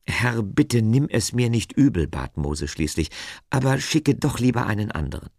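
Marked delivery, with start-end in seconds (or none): 0.1-2.1 high, emphatic plea; Moses, pleading. A narrator's reading. Moses begs off God's task, pleading. 3.5-5.3 high, quick plea; hurried begging to be let off; Moses, pleading. A narrator's reading. Moses asks God to send someone else, pleading. Moses begs off God's task.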